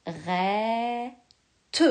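"Rette" drawn out, ending on a rise; stretched dictation. Shown as a demonstration. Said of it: "qui va faire chanter le E est".

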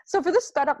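high and fast, sped-up clip; chipmunk voice. Real, not acted. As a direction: sound like tape played too fast. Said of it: "it actually sounds like a chipmunk".

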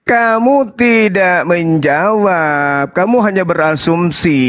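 deliberate rebuke. Real, not acted. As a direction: stretch each word out, low and deliberate. Debater rebuts the opponent's answer.

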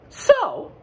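very high and loud; Duck asking. Acted. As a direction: ask it like an eager duck. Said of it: "asked Duck".

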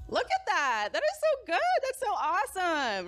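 high-pitched gushing praise; thrilled, soaring exclamations; delighted cheering. Real, not acted. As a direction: gush over them in a high squeal. Cheering dads with the crowd.

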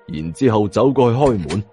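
ordinary delivery

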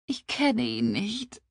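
slow, high tearful admission; kläglich. Acted. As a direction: admit it miserably, choked up. Verheulte Frau gesteht der Freundin. (kläglich und gedehnt)

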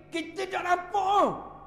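slow, high, stretched line; slow emphasis. Acted. Preacher stressing hidden wisdom to listeners, slow and high. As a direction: draw it out to make the point.

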